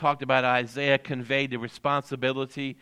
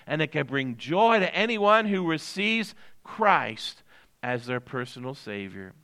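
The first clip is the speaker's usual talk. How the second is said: usual speaking voice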